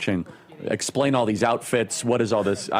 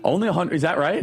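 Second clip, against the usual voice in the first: higher-pitched, surprised double-check; 5 semitones higher than usual. Real, not acted. Host reacting to a surprising fact about the smoke, surprised.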